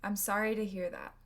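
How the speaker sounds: quick with reduced "to"; fast speech. Shown as a demonstration. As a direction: rush through it naturally. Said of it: "with fast speech in American English".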